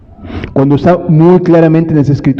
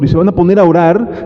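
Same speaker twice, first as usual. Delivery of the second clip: normal talking voice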